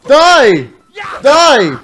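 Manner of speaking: hammed-up murderous yell; vengeful shouting. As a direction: roar it like an executioner. Executing the traitor in game.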